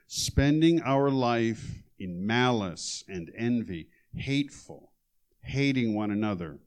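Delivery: loud, measured reading of the passage; scripture reading. Acted. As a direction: read the verses out with weight. Reads scripture aloud.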